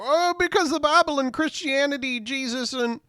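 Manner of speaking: high-pitched mock preacher answer; hammed-up, high mimicry; mocking imitation. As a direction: parody a flustered preacher listing his reasons. Mimics the sheriff's stumbling answer.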